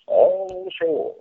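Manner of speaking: high, slow, sung-out all ashore; drawn-out call. Pretend. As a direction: call it out long over the deck. Calling passengers off the boat.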